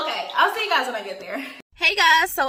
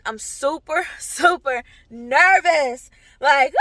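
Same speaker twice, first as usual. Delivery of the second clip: real nerves, higher-pitched and emphatic; nervous; 5 semitones higher, 5 dB louder than usual. Real, not acted. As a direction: let pre-audition jitters lift your voice. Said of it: "I'm so nervous".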